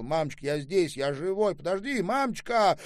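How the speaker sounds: loud, high, climbing insistence; urgent reassurance. A narrator's reading. Son tells hysterical mother he is alive, urgently insistent.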